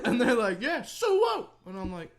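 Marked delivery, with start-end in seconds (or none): 0.6-1.5 very high, loud imitated holler; mock gang call. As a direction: shout back the challenge, hammed up. Stranger repeats the call.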